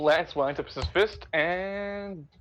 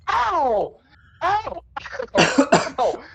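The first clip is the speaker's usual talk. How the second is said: mock pained yelps; 10 semitones higher, 4 dB louder, much slower than usual. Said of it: "Imagine, like".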